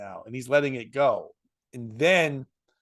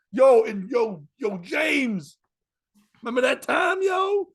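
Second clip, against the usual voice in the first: high-pitched mock shout across a room; imitated calling out; 7 semitones higher, slower than usual. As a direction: drunk stage heckle, retold with raised pitch. Drunk comic calling out friend on stage.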